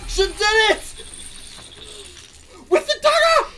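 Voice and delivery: real gloating victory shouts about calling it; ecstatic shouting. Real, not acted. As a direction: scream in delight at being proven right. Celebrating a correct prediction.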